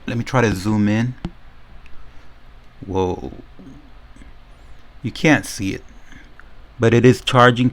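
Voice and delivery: ordinary talk, nothing marked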